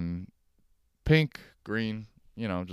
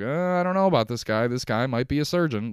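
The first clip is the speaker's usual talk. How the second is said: mock doubtful boss; 5 semitones higher, 5 dB louder than usual. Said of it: "that's like".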